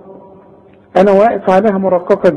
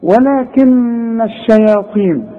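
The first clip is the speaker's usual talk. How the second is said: ولكن الشياطين recited slowly; slow recitation; 4 semitones higher, slower than usual. Shown as a demonstration. Teacher recites ولكن الشياطين slowly. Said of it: "طب اذا وقفت على ولكن".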